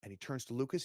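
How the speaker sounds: ordinary delivery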